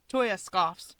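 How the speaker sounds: usual speaking voice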